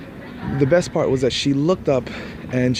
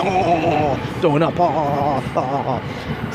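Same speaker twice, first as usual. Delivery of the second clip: mock retching; 3 semitones higher, much slower than usual. Mock disgust at the couple.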